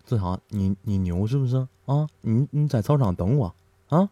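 low, soft menacing bully; bully softly threatens after school. Retold or imitated. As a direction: growl the challenge quietly. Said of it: "这家伙子超就跟我说".

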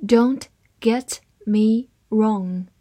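slow, word-by-word model reading; deliberate, spaced-out teaching pace; slow read-aloud. Shown as a demonstration. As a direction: read it slowly with a pause after each word. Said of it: "好，慢慢来读".

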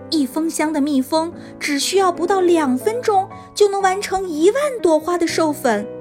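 ordinary delivery

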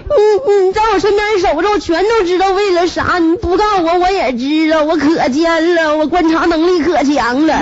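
high-pitched, hammed-up weepy boasting; tearful accusation. Feverish wife claiming she sees through husband, high mock wail. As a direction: wail it, easing slightly toward the end. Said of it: "我一边哭一边说".

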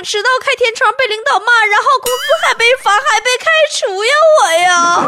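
high, hammed-up complaint building in distress; mock lament. Comedian bewails years of early broadcasts, mock lament. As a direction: pile up the grievances in a plaintive rush.

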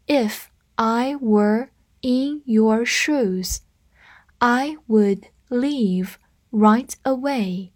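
slow, evenly spaced words; slow read-through. Shown as a demonstration. Teacher modelling a sentence pattern for learners, slow read-through. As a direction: take the sentence slowly, pausing between words. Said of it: "我们慢读一遍".

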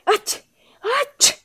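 two high voiced sneezes; acted sneeze, ah-choo; sneezing. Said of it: "光顾着打喷嚏".

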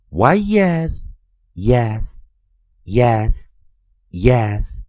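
very slow Y E S, then yes chanted three times; spelling drill. Shown as a demonstration. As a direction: chant yes letter by letter, slowly. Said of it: "再跟老师念一遍".

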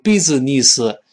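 BUSINESS with long ee and extra syllables; wrong pronunciation. Shown as a demonstration. Teacher warning against a misreading, syllable-by-syllable wrong business. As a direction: say "business" as bee-zi-nee-si. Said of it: "注意，不要读".

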